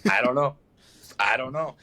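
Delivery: lightly mocked player, low repeated answer; Jair. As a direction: imitate a player giving the same low non-answer. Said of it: "That's how he sounded".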